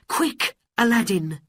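high urgent call. Acted. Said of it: "she called".

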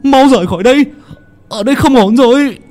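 high, loud panicked shout; alarmed yell. Acted. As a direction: scream at your boss to get out now. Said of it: "quay lại gào lên". (gào lên hoảng hốt)

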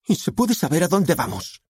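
high-pitched demand; outraged high-voiced question; indignant daughter. A narrator's reading.

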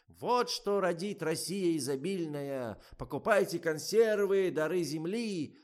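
loud, high, drawn-out proclamation; mock-grand stretched advert cry; crying out slogan. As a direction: proclaim it like a tsar hawking goods. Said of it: "держит в руках тыкву и кричит".

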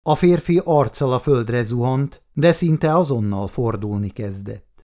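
ordinary delivery

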